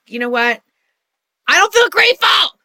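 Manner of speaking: high, loud frustrated protest; exasperated outburst. Retold or imitated. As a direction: blurt it out, fed up. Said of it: "I used to be like".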